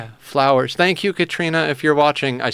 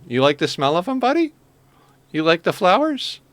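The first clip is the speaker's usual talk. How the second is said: pet talk; 5 semitones higher than usual. Real, not acted. Talking to the cat.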